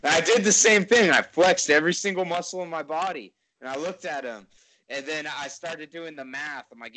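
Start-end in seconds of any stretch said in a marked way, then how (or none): 0.0-3.3 excited. Real, not acted. Loud excited recounting.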